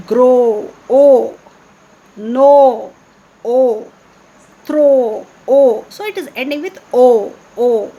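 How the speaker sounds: "grow", "no", "throw" each said loud, long O held; stress on rhyming O. Shown as a demonstration. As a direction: punch the "O" ending of each word loudly. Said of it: "the ending sound you observe".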